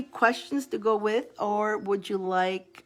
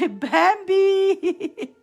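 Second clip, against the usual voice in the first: higher, louder, stretched-out name with laughter; laughing call; 11 semitones higher, 7 dB louder than usual. Real, not acted. Host calling on Bambi to go later, laughing. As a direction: sing out the name as the laugh takes over.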